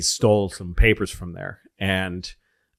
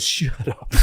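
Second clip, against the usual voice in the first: mock grumpy Saddam; 3 semitones higher than usual. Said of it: "he goes".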